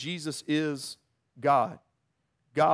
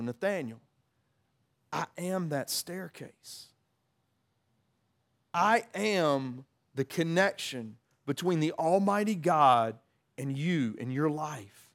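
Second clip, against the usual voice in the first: tender; slower than usual. Retold or imitated. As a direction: reveal it gently, like a whisper of love. Jesus voiced, intimate revelation.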